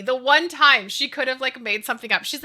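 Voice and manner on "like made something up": ordinary talk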